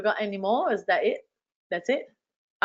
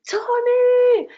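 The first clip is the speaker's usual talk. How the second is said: very high, loud shout across the room; calling out; 7 dB louder than usual. Retold or imitated. Fan running to the stage calling out to Tony, loud and high. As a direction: yell his name to grab his attention.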